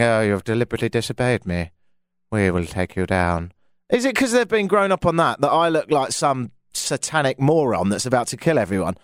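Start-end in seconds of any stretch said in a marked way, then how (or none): 0.0-3.5 low, measured British baddie; mock-sinister British accent, slowing at the end; British movie villain. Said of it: "a guy with a British accent saying".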